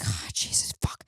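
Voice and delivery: breathless whispered curse; a shocked whisper to yourself; whispered panic. Retold or imitated. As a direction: hiss it under your breath in dread. Panicked reaction on the plane.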